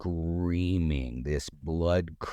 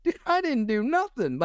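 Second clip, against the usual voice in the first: defensive denial; 4 dB louder than usual. Retold or imitated. Himself denying to elder.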